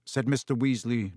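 plain reading voice

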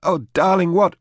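pitched high and loud, anguished; a wife crying out in horror; distraught cry. A narrator's reading. Julia cries out to Royan in horror, anguished.

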